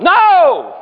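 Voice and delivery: sudden high shout of no; yelled no. Retold or imitated. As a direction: burst out shouting no. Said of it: "And I yelled".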